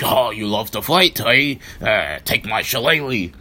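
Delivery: loud, hammed-up fake Irish accent; terrible Irish accent. Imitating Finlay answering Becky at ringside, loud terrible Irish accent. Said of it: "that's a terrible Irish accent".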